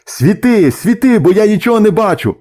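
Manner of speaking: high, urgent demand; shouting to crew. A narrator's reading. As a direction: holler impatiently toward the wheelhouse. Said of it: "чолов'яга закричав до рубки".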